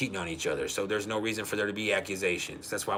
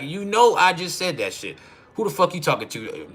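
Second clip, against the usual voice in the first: raised, louder mock-argument voice; retold combative retort; 7 semitones higher, 5 dB louder than usual. Wife talking back to husband in a spat, combative.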